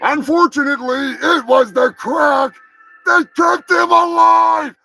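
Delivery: building into a loud, angry shout; escalating self-directed fury; furious outburst. Acted. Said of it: "I'm a little worked up".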